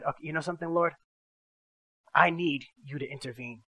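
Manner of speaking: a quiet plea to God; hushed prayer. Retold or imitated. Man praying in a service for help, hushed and pleading. Desperate prayer during worship.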